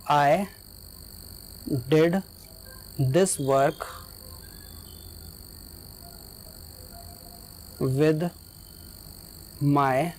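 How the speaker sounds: long pauses between words; slow dictation. Shown as a demonstration. Teacher dictating the second example to students, word by word. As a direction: read it out word by word.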